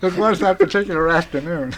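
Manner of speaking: big laugh, then words still laughing. Real, not acted. Guest laughing at his own NBC nudity story, speaking through laughter. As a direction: crack up and talk through the laughter.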